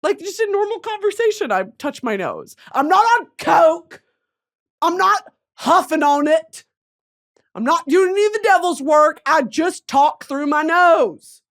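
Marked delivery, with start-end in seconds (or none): 2.7-11.4 loud, slow and insistent; emphatic denial. Real, not acted. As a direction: hammer each denial home. Defending nose habit loudly.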